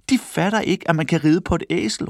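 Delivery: calling out. A narrator's reading. Another onlooker mocks them for not riding, calling out. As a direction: ridicule them in a high voice. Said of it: "råbte en anden".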